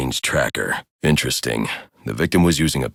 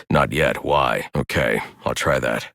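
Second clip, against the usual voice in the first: ordinary talk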